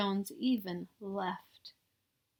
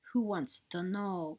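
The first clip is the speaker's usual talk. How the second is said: growling voice; 2 semitones lower, 5 dB quieter than usual. Acted. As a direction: growl it from the shadows. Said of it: "growled a voice from inside".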